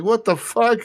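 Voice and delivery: high incredulous cry; mock outrage. Reacting to leg shots.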